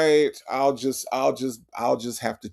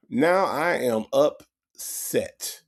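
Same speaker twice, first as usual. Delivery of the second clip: slow "Now I am upset" with AM leaned on; slow, stressed repeat; much slower than usual. Shown as a demonstration. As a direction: spell out "Now I am upset" slowly. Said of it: "repeats it slower".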